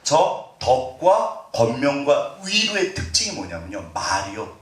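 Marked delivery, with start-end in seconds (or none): none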